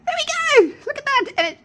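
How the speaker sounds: very high, loud cry of triumph; thrilled squeal. Real, not acted. Crafter seeing the eyes match the spots, thrilled. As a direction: squeal it in sudden delight.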